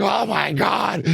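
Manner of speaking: drawn-out, high, loud oh my God; a slow, loud cry of delight; excited exclamation. Retold or imitated. Producer marvelling at the drum sound, drawn-out and loud. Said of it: "we're all like".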